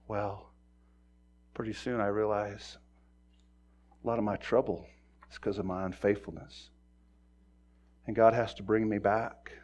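ordinary talk, nothing marked

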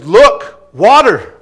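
loud, high exclamations, each word apart; excited cry. Retold or imitated. A man spots water to be baptized in, loud excited cry. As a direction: call it out as a sudden discovery.

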